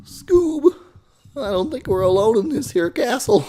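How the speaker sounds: high cracking Shaggy voice. Shown as a demonstration. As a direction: warn Scooby from the voice crack, high. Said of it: "this starts to sound like me again".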